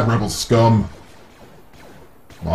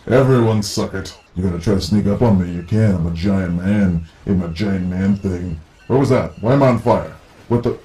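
ordinary talk, nothing marked

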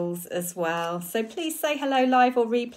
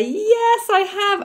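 much higher, delighted yes; triumphant yes; 12 semitones higher, 6 dB louder, much slower than usual. Real, not acted. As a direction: proudly confirm she got it right. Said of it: "have I pronounced it right today".

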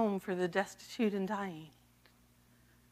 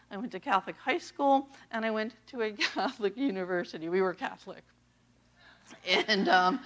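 usual speaking voice

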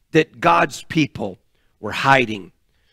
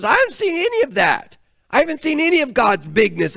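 exasperated Gideon; 9 semitones higher, faster than usual. Acted.

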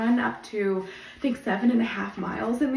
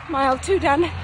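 louder, proud mile shout; upbeat call-out; 4 semitones higher, 6 dB louder than usual. Real, not acted. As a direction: announce the milestone with energy. Calling out mile while running.

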